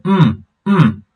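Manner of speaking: hummed 'mm' falling from high; closed-mouth falling tone. Shown as a demonstration. Teacher shows the tone with mouth closed, falling hummed 'mm'. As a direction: hum each 'mm' with lips closed, dropping steeply. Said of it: "close your mouth and just make that voice".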